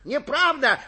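loud high-pitched cry of denial; shouting. A narrator's reading. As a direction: shout it out in protest. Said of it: "кричал Суханок". (громкий протестующий крик)

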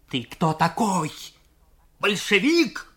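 shrill rising cry in his face; hysterical shout. Acted. Junker yelling in Nikolai's face, hysterical rising shout. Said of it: "истерически крикнул ему в лицо".

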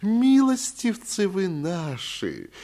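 high, drawn-out wheedling sing-song; singsong fawning. A narrator's reading. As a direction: croon it like a tearful flatterer. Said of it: "заговорил он нараспев и с таким умилением".

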